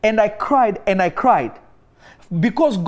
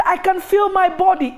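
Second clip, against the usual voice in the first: higher, amazed voice of the woman; amazed healed woman; 9 semitones higher than usual. Retold or imitated. Paralyzed woman telling him she feels her body, high and amazed. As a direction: let the wonder lift the voice.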